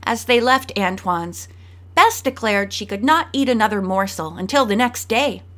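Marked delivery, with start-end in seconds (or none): none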